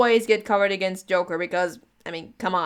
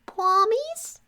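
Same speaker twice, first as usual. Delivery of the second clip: much higher, sing-song hopeful; mocking hope. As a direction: tease the line as if delighted. Mocking one-word reply.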